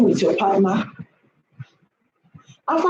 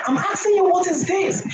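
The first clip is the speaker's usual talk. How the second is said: high, shrill-free mock yell, much higher in pitch; imitating a shouting wife; 7 semitones higher than usual. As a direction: play the nagging wife shouting at her man. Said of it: "if you start shouting".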